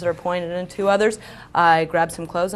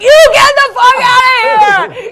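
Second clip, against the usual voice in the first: full-out, high-pitched hysterical scream; acted drunken shriek, very loud and stretched; 14 dB louder than usual. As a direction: scream him away in tearful, drawn-out desperation. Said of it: "just scream at him".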